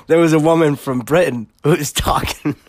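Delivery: fighting laughter to get the sentence out; real laughing fit. Real, not acted. Podcast host recounting a funny accent video, cracking up. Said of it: "I'm crying right now laughing".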